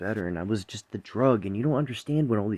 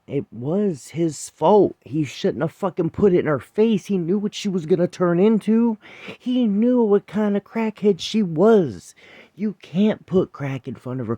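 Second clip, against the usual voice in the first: much higher and louder, fury pouring out; real angry rant; 11 semitones higher, 9 dB louder than usual. Real, not acted. Venting at the viewers about Frank, in a real furious shout. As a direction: shout it out, truly furious at him.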